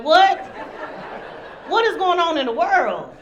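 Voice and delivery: loud, high-pitched comic disbelief; incredulous outburst. Acted. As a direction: burst out in mock outrage. Acted disbelief at being stood up.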